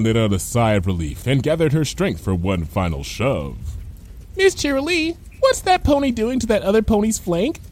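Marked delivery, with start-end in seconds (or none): 4.4-7.6 high-pitched innocent pupil; small, high schoolchild voice; small child's voice. A narrator's reading. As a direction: ask like a curious little kid. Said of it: "came a small voice from behind Bon Bon".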